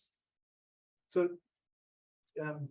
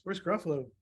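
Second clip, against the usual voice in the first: usual speaking voice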